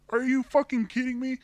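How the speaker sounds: sarcastic thanks rising to a loud high curse; bitter furious rant. Real, not acted. Cursing Toys R Us for no support, sarcastic then shouting. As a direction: spit out the fake thanks, then explode on the curse.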